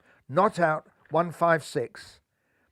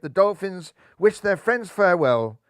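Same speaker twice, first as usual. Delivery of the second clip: plain reading voice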